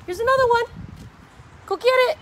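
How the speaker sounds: high, loud call to the dog; excited calling out. Real, not acted. Owner throwing another ball for her dog, excited calling out. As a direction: pitch it up and urge him after it.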